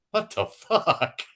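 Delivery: high and slow, breaking into a laugh; can't believe it, cracking up; laughing disbelief. Real, not acted. Reacting to Mario's claims with high, slow laughing disbelief.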